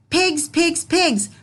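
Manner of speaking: bang out each pigs; yelling wolf. Acted. Wolf yelling at the pigs to open, high mock yell. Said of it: "he yelled".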